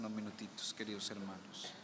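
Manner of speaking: soft, low opening words, hard to hear; quiet opening greeting; barely audible greeting. Real, not acted. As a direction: murmur the greeting softly. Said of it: "dicen que no se escucha".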